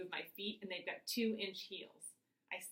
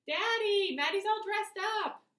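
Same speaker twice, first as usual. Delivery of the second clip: put-on high, loud excited little boy; excited shout; 10 dB louder than usual. A narrator's reading. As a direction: shout the news to daddy.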